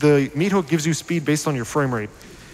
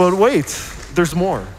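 infomercial pitch; 5 semitones higher, 5 dB louder, slower than usual. Pretend.

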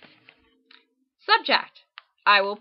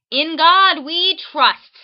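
loud, slow, each word hammered home; all caps shout; 6 semitones higher, 9 dB louder, slower than usual. Shown as a demonstration. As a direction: shout it like a capital-letter sign. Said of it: "in all caps".

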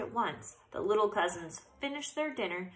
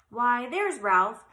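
loud, slow squeaky aunt voice; a lightly voiced prim aunt squeaking hello; 5 semitones higher, 8 dB louder, slower than usual. A narrator's reading. Aunt Sissy greeting Ralph, loud mock squeak. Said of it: "squeaked his Aunt Sissy".

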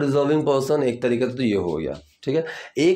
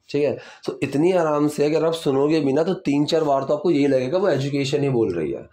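ordinary talk

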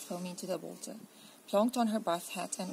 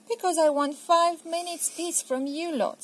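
louder, higher fed-up mum; exasperated mother; 9 semitones higher, 8 dB louder than usual. Acted. Mum tells children she wants peace, exasperated. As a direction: at the end of her tether.

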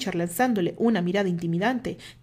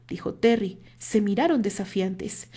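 ordinary delivery

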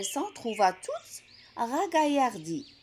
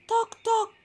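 knocking sound; 10 semitones higher, 3 dB louder than usual. A narrator's reading. Wolf knocking at door.

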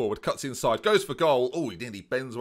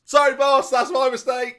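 very high, very loud yell; shouted apology; 13 semitones higher, 11 dB louder than usual. Acted. Player apologising to his boss at a shout. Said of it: "Shouting, please".

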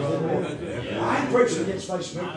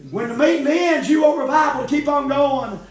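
raised preaching voice; hollering revival preacher; 6 semitones higher, 5 dB louder than usual. Acted. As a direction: call it out to the congregation. Preaching loud.

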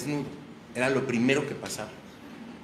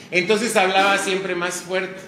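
loud; 5 semitones higher, 6 dB louder, slower than usual. Retold or imitated. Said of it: "usaba un aparato de sordera".

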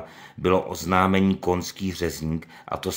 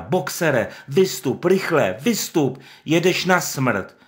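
much higher, louder pleading yell; desperate shout; 9 semitones higher, 6 dB louder, slower than usual. Acted. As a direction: scream the warning at him. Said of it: "křičela zoufale Lupina".

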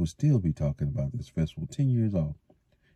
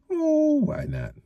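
much higher, slow resigned sigh; much slower than usual. A narrator's reading. Matt agreeing to help carry casks, resigned. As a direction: give in reluctantly. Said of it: "Matt said resignedly".

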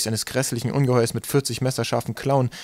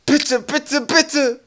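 high, loud begging; desperate pleading cry; 6 dB louder, much slower than usual. A narrator's reading. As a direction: plead at the top of your voice. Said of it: "rief er".

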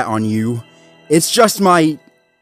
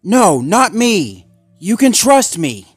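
high, insistent plea; loud, anxious denial; pleading reassurance; 11 semitones higher, 9 dB louder, slower than usual. Acted. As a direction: beg to be believed. Zuma pleads to be trusted.

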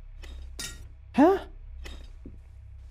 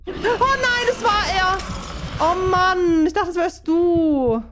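panicking gamer; 9 semitones higher, slower than usual. Real, not acted. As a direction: shriek in real fright. Ghost mistaken for friend. (panischer Gamer)

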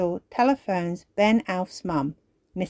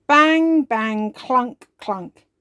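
ordinary delivery

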